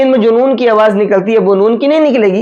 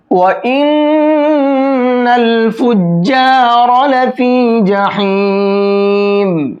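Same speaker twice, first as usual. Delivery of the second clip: slow, drawn-out melodic tajweed recitation; Quranic recitation; much slower than usual. Acted. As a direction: chant the verse slowly, holding each long vowel. Recites the verse. (آہستہ، کھینچ کر تجوید کے ساتھ تلاوت)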